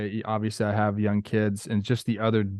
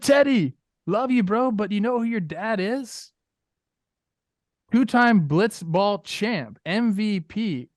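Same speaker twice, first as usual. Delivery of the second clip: mock boastful dad; 8 semitones higher, slower than usual. Mock bragging dad telling his young son who's boss. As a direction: brag to your kid like a champ, drawing out the title.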